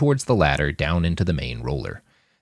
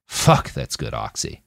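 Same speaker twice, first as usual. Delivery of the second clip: drugged thought; 9 semitones lower, slower than usual. A narrator's reading.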